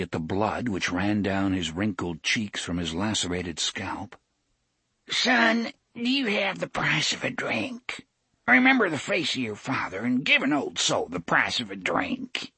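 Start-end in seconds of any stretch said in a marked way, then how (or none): 5.1-12.5 put-on high, wheedling old beggar; old man voice. A narrator's reading. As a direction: beg in a high quavering voice. Old beggar pleads for drink.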